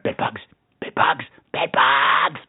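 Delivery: whispered bed bug panic, barely voiced; panicked whisper. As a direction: hiss it like a paranoid inner voice. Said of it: "my New York City brain went".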